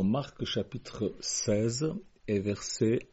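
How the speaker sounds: slow, deliberate and clearly articulated; over enunciating. Real, not acted. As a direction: spell it out slowly for note-takers. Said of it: "je parle lentement pour que ça entre bien".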